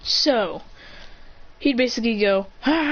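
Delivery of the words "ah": high, held "aah"; long mock cry, pitched up; imitated battle yell. Storyteller imitating the attack that destroys him, mock yell.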